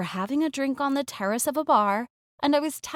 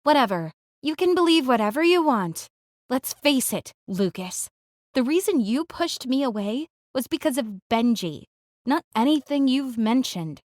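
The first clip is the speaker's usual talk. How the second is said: raised voice; 4 dB louder than usual. Acted. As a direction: hit back with your voice raised. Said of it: "her voice rises".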